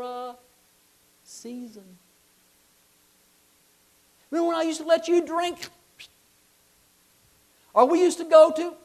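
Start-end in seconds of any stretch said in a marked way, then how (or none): 4.3-8.8 put-on high, loud wheedling old man; loud coaxing tempter. Tempter in the jail calling to the believer, high and loud. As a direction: lure him back, calling up high.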